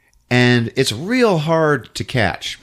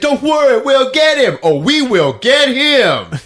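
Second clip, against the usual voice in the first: high, loud assurance; mock hero proclaiming loudly; hammed-up heroic vow; 13 semitones higher, 6 dB louder than usual. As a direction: build to a slow, emphatic promise. Rescuer loudly vows action.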